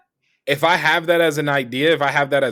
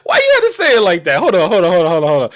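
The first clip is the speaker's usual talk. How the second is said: incredulous laughing protest; 5 semitones higher, 5 dB louder, faster than usual. Real, not acted. Reacting to friend's phrasing.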